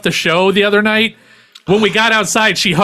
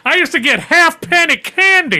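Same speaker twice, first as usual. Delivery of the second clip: put-on old prospector; 7 semitones higher than usual. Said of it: "talking like an old timer".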